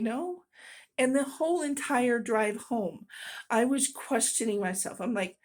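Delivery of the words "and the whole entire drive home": usual speaking voice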